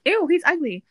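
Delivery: high, loud retold ew; mock disgust. Friend's disgust acted in retelling.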